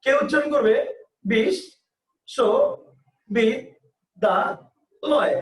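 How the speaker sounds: বিশ্ববিদ্যালয় drawn out very slowly, syllable by syllable; বিশ্ববিদ্যালয় split into five slow separate beats. Shown as a demonstration. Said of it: "এক একজনের উচ্চারণ এক এক রকম".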